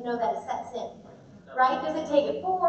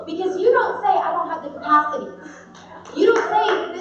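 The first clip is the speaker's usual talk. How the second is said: loud, raised, preaching to the room; emphatic declaration; 6 semitones higher, 7 dB louder than usual. Real, not acted. Rallying the chiropractors in the room, loud and emphatic. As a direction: drive it home to the doctors.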